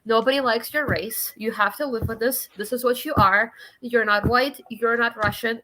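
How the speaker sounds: ordinary talk, nothing marked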